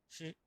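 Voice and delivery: "shi" en primer tono del mandarín; primer tono chino. Shown as a demonstration. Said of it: "primer tono". ("shi" en primer tono del chino)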